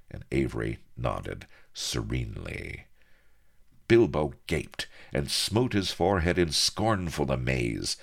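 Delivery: plain reading voice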